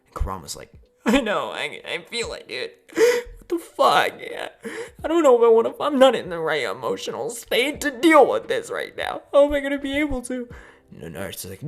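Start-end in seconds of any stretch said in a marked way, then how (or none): 1.0-10.5 high, loud, tearful wail; hammed-up crying panic, overwhelmed; panicked meltdown. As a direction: whine and wail as if falling apart. Acting Kurama panicking and falling apart.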